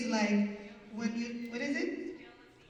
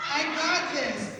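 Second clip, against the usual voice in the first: loud, higher, slow affirmation; 4 semitones higher, 5 dB louder, slower than usual. Shown as a demonstration. Host repeating the affirmation to the audience, proudly. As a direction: declare it proudly and slowly. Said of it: "Let's say it".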